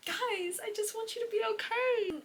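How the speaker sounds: high, whiny plea to the characters; high pleading whine. Real, not acted. Reader begging the characters to be okay, whining high. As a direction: beg them to be safe, near tears.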